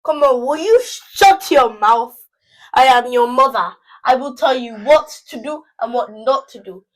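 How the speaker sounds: put-on loud, commanding mother telling off a teen; hammed-up furious mom, very loud; angry Nigerian mama. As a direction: lay down the law as a Nigerian mom. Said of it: "What do you think a Nigerian mama would say".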